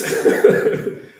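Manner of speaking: short unvoiced laugh; high laugh. Real, not acted. Preacher laughing at his own Judas line, laughing. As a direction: break into a laugh.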